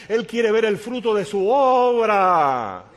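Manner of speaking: loud, slow, high proclamation; a swelling pulpit cry; preaching at full force. Acted. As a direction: drive it home to the crowd. Preaching climax.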